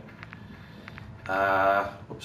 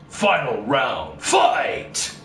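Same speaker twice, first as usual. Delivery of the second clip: slow, shouted final round call; Shao Kahn shouting; 3 semitones lower, much slower than usual. Retold or imitated. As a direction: bellow the last round like the arcade announcer. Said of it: "feel like Shao Kahn from Mortal Kombat".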